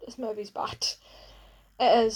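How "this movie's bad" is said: sighing whisper of disgust. Real, not acted. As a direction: breathe it out as a whisper. Whispered aside after a sigh.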